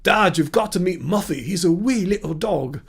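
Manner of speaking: a put-on Scottish accent, talking to dad about the dog. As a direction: try a convincing Scottish accent. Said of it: "How's that?".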